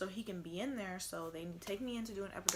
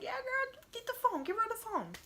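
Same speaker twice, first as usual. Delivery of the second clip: much higher and louder, excited; eager excitement; 6 dB louder than usual. Retold or imitated. Mom telling her boyfriend to hand over the phone, excited. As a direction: squeal yes, give her the phone.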